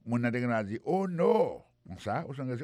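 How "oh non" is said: high startled exclamation; high surprised oh no; shocked. Acted. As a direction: react with sudden alarm. Acted shocked reaction.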